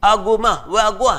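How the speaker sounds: high, loud street greeting in clipped dialect. Retold or imitated. Teacher imitates his old street neighbours' greeting, high and loud. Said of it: "This is the English they speak".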